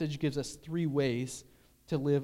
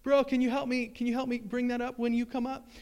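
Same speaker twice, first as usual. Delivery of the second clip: high, raised calling-out voice; acted yell; 8 semitones higher, 4 dB louder than usual. Sledder calling to people at the bottom, high acted yell. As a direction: call down the hill for a favour. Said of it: "you gotta yell at people at the bottom".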